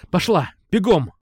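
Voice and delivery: sharp urging to go; urgent order. A narrator's reading. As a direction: send her off running. Ordering her to run.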